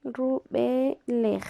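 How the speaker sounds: Rubelej drawn out syllable by syllable; slow dictation. Shown as a demonstration. Teacher to class while writing, Rubelej drawn out slowly. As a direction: say Rubelej slowly as you write it. Teacher writes the new word.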